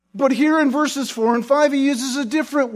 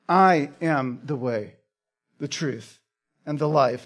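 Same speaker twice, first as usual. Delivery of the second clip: hushed solemn; 8 semitones lower, 6 dB quieter than usual. Shown as a demonstration. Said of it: "solemn restatement of the verse".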